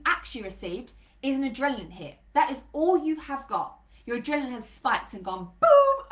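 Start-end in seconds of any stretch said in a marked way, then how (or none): 5.6-6.1 loud, drawn-out sound effect; booming imitated burst; explosive boom. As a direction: blast it out like an explosion. Imitates adrenaline spike.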